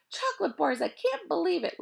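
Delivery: usual speaking voice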